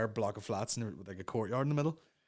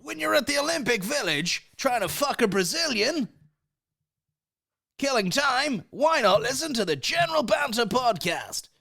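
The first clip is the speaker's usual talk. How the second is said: high, loud announcer shout; over-the-top shouted promo; podcast intro read; 13 semitones higher, 5 dB louder, slower than usual. Acted.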